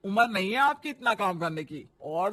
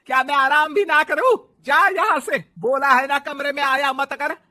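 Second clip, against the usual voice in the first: scolding; 5 semitones higher, 6 dB louder than usual. Acted. Mother-in-law scolding.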